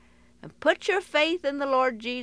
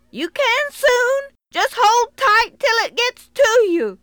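calling out; 14 semitones higher, 11 dB louder than usual. Acted. Cowboy reassures boy.

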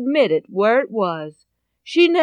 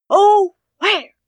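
high, loud anguished cry of Oh, where; frantic wail; 8 semitones higher, 7 dB louder than usual. Acted. Mother searching everywhere for her money, frantic high cry.

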